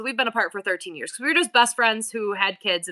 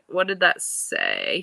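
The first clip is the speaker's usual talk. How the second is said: usual speaking voice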